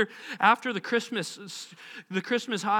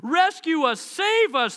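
high, urgent shriek; shrieked plea; 7 semitones higher, 4 dB louder, slower than usual. A narrator's reading. Disciples beg Jesus for rescue, shrieking. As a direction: shriek it as if about to drown. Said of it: "they shrieked".

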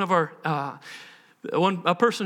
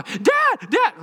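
put-on child calling; 5 dB louder than usual. Son calling again.

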